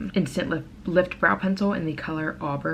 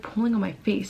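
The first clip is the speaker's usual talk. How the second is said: ordinary talk, nothing marked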